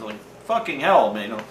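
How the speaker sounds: ordinary talk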